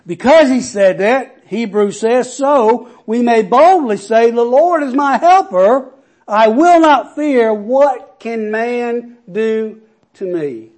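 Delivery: loud, high, rising preacher's cry; preaching at full force. Acted. Pastor rousing the congregation with the promise, preaching loud. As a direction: build the scripture up like a sermon climax.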